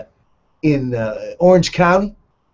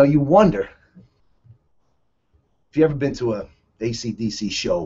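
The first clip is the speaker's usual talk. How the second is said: usual speaking voice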